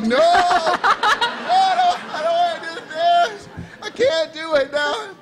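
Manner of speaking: laughing refusal. Retold or imitated. Refusing the author's request, laughing panic. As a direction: squeal it out, mortified and giggling.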